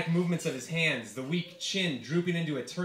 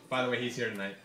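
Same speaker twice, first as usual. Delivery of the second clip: ordinary delivery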